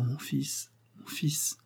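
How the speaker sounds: breathless whisper, barely voiced. Dying mother to her long-lost son, whispered. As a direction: whisper it as if you can't believe it.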